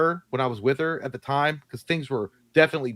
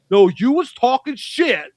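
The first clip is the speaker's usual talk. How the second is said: loud, high, drawn-out mock outburst; slow, shouted accusation in imitation; retold angry accusation; 11 semitones higher, 8 dB louder, slower than usual. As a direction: imitate a worked-up guy picking a fight. Retold man picking a fight.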